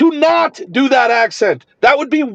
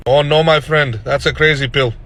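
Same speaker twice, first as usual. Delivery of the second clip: put-on lower-pitched doctor with an Indian accent; 9 semitones lower than usual. Doctor telling a friend about the pill, Indian accent. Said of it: "I use an Indian accent in the video".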